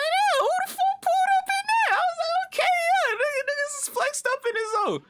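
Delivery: very high, loud squealed reaction; over-the-top high-pitched awe at a flashy sight; hyped-up amazement. Retold or imitated. Acting out amazed reaction to flashy truck.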